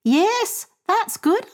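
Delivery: high-pitched, approving mother; pleased mother. Acted. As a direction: answer your son up high with approval. Mother answers son.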